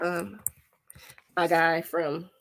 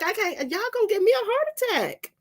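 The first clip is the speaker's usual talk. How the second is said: exasperated mom; 12 semitones higher than usual. Retold or imitated. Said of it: "I'm like".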